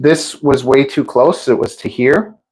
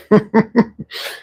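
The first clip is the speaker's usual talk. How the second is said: high giggle; 9 semitones higher than usual. Real, not acted. Happy about her sink extender.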